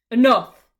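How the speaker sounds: loud, commanding bark. Acted. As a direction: bark the order. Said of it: "Mark barks".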